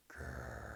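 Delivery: very low, soft rumbling growl. Acted. Narrator voicing the mystery sound, a low growl. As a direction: rumble it deep like a bear's belly.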